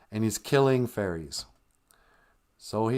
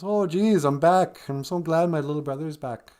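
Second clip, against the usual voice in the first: mock Sarah; 8 semitones higher, 3 dB louder than usual. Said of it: "she goes".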